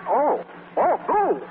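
hammed-up amazement at her entrance; an older man gushing over the girl; delighted surprise. Feigned delight at transformed Boo.

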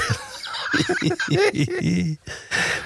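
high, airy burst of laughter; big laugh. Real, not acted. Laughing at mispronunciation.

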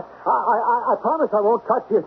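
high, fast, laughing plea; unhinged giggling promise; manic laughing. Acted. Laughs madly while pleading.

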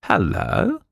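a startled, rising "Hello!"; surprised cry. A narrator's reading. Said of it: "he cried".

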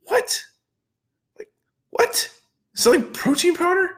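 high, squeaky "what?" of total disbelief; mock shock, voice jumping way up; stunned disbelief. Gym owner hearing his friend's sales figure, squealing disbelief.

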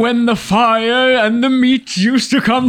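mock elderly caveman recalling the good old days; old grandad voice. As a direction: play a rambling old grandad. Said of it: "All right, Grandad".

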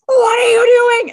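high, loud, drawn-out mock shout; Din's alarmed outcry. Din reacting to a baby brought into battle, mock alarm. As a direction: cry out in exaggerated alarm, slowly. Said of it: "And Din's like".

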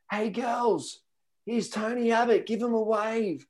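put-on shouting to passing girls; mock yell. Mate calling out to the girls, high yell. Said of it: "And my mate yells out".

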